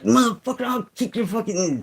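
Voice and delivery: hammed-up, high retort; mock angry outburst. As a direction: act out losing your temper in a high voice. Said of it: "then you turn around like".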